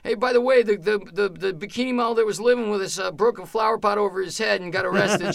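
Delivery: put-on high-pitched, gushing drunk chatter; drunk buddies. Drunk roommates filling in the new guy, high and over-friendly. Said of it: "drunk off their ass".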